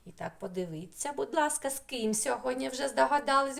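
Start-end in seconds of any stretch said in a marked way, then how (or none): none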